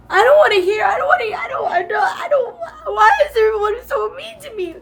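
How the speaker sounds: high, loud tearful outburst; crying protest. Real, not acted. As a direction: wail it as a hurt plea, breaking off and restarting. Crying over being treated meanly.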